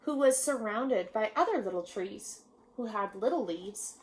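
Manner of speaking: usual speaking voice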